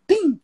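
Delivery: imitated ruined tom. Shown as a demonstration. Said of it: "garantindo tom um de novo".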